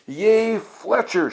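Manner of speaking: high, bright cheer from the crowd of balls; excited group cheer. A narrator's reading. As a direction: cheer him on, pitched well up. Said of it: "shouted all the balls".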